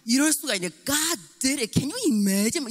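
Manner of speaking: a mother marvelling aloud, excited; excited high tone. Retold or imitated. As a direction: gush over what God did. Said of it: "이렇게 나가는 게 엄마 스타일이에요".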